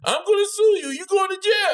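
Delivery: high-pitched mock yell of the injured man; imitating yelling. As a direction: shout the threat like the man under the car. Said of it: "He's talking about yelling".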